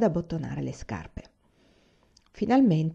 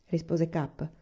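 ordinary delivery

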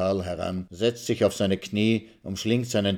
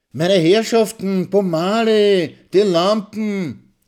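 high, loud, slow Viennese waiter's cry; alarmed waiter; 12 semitones higher, 8 dB louder, slower than usual. Acted. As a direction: call over the brawl. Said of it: "die Stimme des Kellners".

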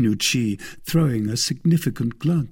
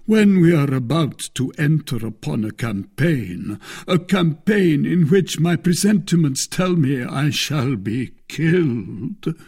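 melancholy; 6 semitones higher, 5 dB louder than usual. A narrator's reading. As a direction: lay on a mournful foreboding. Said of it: "in the most melancholy tone".

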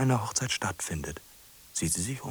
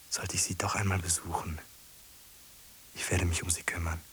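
whisper; 4 dB quieter than usual. Acted. Whispered resolve.

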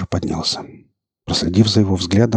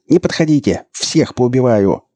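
high, frantic warning cry; raised, hate-filled threat; threatening shout; 5 semitones higher, 3 dB louder than usual. A narrator's reading. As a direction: shout them back like a madman. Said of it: "закричал он".

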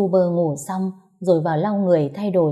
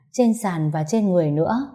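plain reading voice

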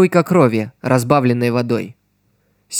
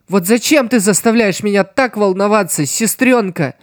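higher, slightly trembling happy voice; voice shaking with joy; 7 semitones higher than usual. Acted. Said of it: "Чуть дрожащим от радости голосом". (голос чуть дрожит от радости)

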